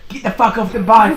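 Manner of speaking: mock shout.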